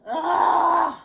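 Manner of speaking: loud, high exasperated "ugh"; long fed-up groan of a child; disgusted snort. A narrator's reading. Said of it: "he snorted".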